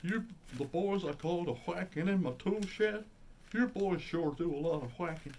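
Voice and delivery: high cartoon Texan neighbor; Mister Anderson impression. Old man complains to boys about his tool shed, cartoon impression. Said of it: "it's Mister, what was he called".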